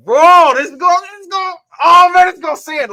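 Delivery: high, loud, can't get the words out; too hyped to finish the sentence; excited. Real, not acted. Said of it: "You can't even talk".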